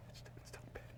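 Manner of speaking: urgent excited whisper; retold whisper. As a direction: hiss the name so the caller won't hear. Roommate whispering about caller.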